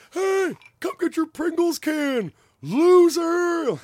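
high, loud mocking yell; jeering shout. Stranger mocking a sunburnt man walking away, loud jeering yell. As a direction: taunt him across the beach. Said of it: "Someone goes".

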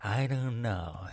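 light mock Biden impression, slow on the last words; lightly voiced Jim Carrey playing Joe Biden; a hint of Carrey's Biden drawl. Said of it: "it's more like".

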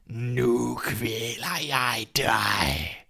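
very slow, drawn-out corpse voice; dead man's threat. A narrator's reading. Dead man rising against the boy, menacing drawl. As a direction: stretch every word like the risen dead. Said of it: "den døde rejste sig op og råbte".

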